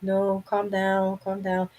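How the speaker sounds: drawn-out hush to herself; slow calming self-talk. Retold or imitated. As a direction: ease yourself down, slowly. Retold self-talk during panic.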